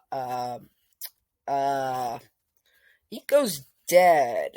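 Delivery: ordinary delivery, nothing marked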